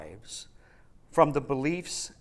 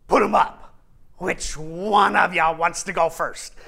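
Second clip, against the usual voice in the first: put-on lion voice, pitched up then settling; blustering lion; 5 dB louder than usual. Lion challenges the travelers to fight, blustering. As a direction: square up like a comic prizefighter. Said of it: "I love that scene where he says".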